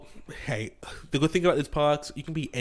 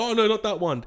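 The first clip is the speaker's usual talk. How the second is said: much higher, mock dismayed lady; wand lady; 9 semitones higher, 4 dB louder than usual. Retelling the wand shop lady, high mock dismay. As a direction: squeak it like a fussy shop lady. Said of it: "she'll be like".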